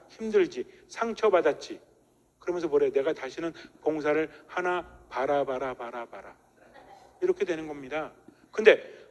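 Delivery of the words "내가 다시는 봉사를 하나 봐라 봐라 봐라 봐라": fading, lower, softer sulking vow; sulky grumble. Retold or imitated. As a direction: mutter it like a hurt, sulking volunteer. Sulking parishioner vowing to quit. (봉사에 지친 신자가 토라져서 점점 작아지는 목소리로)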